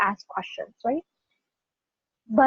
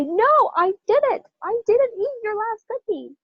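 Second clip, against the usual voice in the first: denying an accusation; 11 semitones higher than usual. Acted. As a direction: protest your innocence against the accuser. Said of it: "it sounds like somebody's accusing me".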